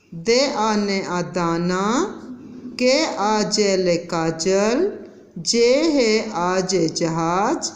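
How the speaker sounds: slow, sound by sound; slow read-along. Shown as a demonstration. Teacher leading children to read with her, slow. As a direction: let the class read along with you. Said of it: "एक बार और पढ़िए मेरे साथ".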